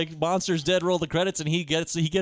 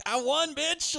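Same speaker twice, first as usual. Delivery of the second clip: triumphant; 10 semitones higher, 4 dB quieter, much slower than usual. Pretend. Imagined triumphant line.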